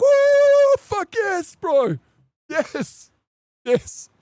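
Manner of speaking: very high whoop, then exultant yeses; jubilant celebration. Retold or imitated. Patient choosing heart condition over cancer, jubilant cheering.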